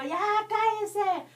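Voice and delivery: high, drawn-out yell to shut someone up; demonstrated yell. Acted. Teacher mimics pupils shouting at a neighbour, annoyed yell. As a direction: raise the pitch and stretch it, telling someone off. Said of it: "cuando ustedes le gritan a mamá".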